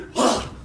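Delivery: quick breathy gasp; quick demo gasp. Shown as a demonstration. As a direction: snap the breath in as you jump. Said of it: "take a deep breath in".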